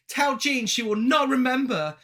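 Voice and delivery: put-on Cockney accent. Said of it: "giving her a Cockney accent".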